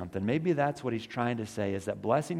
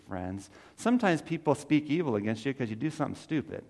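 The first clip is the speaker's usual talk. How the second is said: ordinary talk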